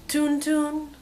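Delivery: ordinary talk, nothing marked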